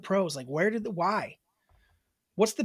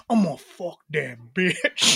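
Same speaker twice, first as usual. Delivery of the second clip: slow, low mock menace; hammed-up threat, drawn out and lower; mock angry; 4 semitones lower, slower than usual. Host demonstrating vulgar swearing to co-host, mock angry. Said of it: "when you're saying it angrily".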